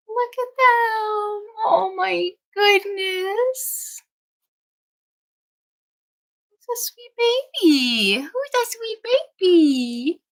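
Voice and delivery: high, slow sing-song to the puppies. Real, not acted. Greeting newborn puppies in high baby talk. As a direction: coo at them like babies.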